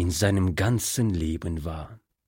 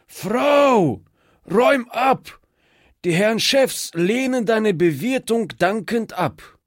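high, raised, strained shout to his wife; hoarse call; 12 semitones higher than usual. A narrator's reading. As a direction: call out sharply at a much higher pitch. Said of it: "rief mit heiserer Stimme". (mit heiserer Stimme hoch gerufen)

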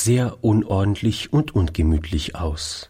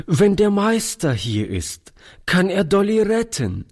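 urgent plea; 11 semitones higher than usual. A narrator's reading. Toni urgently begging grandfather.

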